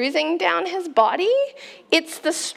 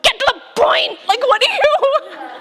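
exasperated, higher and louder demand; impatient outburst; 10 semitones higher, 5 dB louder than usual. Retold or imitated. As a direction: snap at a rambling mum to hurry up. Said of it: "and I was like".

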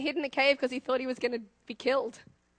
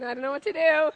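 ordinary delivery

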